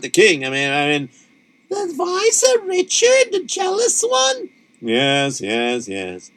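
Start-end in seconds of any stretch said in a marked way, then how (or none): 1.7-4.5 high, slow put-on Yiddish wife voice. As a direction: play the worried wife, pitched way up. Wife voice cuts in.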